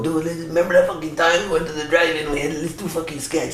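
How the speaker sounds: a hint of a stoned buddy pitching a sketch; lightly voiced lazy stoner; stoned dude. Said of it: "smoke a bowl and go".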